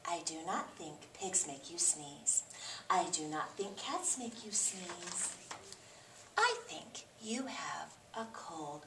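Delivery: soft, hushed diagnosis; whispered doctor cat. Acted. Doctor Cat quietly tells Gerald he has a cold. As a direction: gently break the news.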